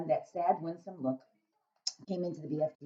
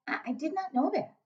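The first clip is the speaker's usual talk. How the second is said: put-on little girl voice; 12 semitones higher than usual. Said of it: "Sophie said".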